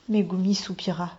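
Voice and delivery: ordinary delivery, nothing marked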